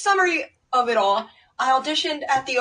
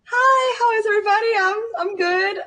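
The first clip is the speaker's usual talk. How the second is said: shaky, teary high voice; tearful and flustered; after crying; 10 semitones higher than usual. Real, not acted. Guest nervous, emotional on arrival.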